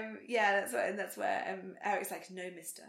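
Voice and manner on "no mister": put-on very soft Swedish-accented line; quoting Eric in a Swedish accent. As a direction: murmur it in a light Swedish accent. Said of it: "I said it in a Swedish accent".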